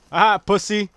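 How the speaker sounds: loud, high, triumphant jeer; gloating taunt. Real, not acted. Gamer jeers at an opponent, loud gloating taunt. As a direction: crow it at a beaten rival.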